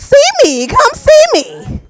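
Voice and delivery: very high, loud mock plea; urgent invitation. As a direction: cry it out high, dragging the end. Urging him to come to shows.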